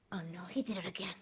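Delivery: fast, breathless whisper. Real, not acted. Whispering as the cat hides again. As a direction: whisper it quickly so the cat won't hear.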